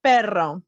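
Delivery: "Perro" with a trilled double R; rolled R. Shown as a demonstration. Teacher modelling practice of rolled R's, trilled R. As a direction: roll the R firmly in "Perro". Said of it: "learn to roll your R's".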